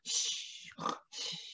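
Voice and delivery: soft hushing; sleeping bear, shh; whispered shh. Acted. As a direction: shush the children for the sleeping bear.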